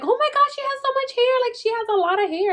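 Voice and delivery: high, fast gushing voice; gushing relatives. Retold or imitated. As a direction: coo over the baby's hair. Everybody gushes over baby's hair.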